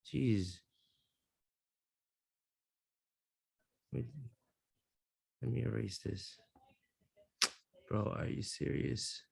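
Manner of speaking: very quiet, low grumble; hushed self-directed aside; muttering to oneself. Real, not acted. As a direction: grumble under your breath at your own drawing. Grumbling to self while erasing.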